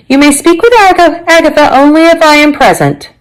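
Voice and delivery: high-pitched, firm condition; stern grandmother. Acted.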